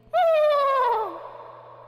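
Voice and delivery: loud, high burst of laughter; high shrieking laugh. Real, not acted.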